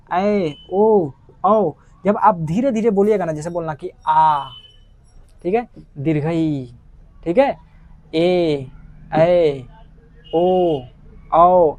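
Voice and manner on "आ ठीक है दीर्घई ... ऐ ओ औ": each long vowel held slow with pauses between; slow careful vowel drill, held long; drawn-out vowels. Shown as a demonstration. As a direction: stretch every vowel out, letting it ring. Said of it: "ऐसे बोलना".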